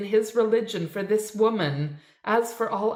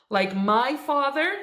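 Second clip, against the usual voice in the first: bitter shrill mockery; 6 semitones higher than usual. A narrator's reading. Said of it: "Naomi's shrill laughter pealing".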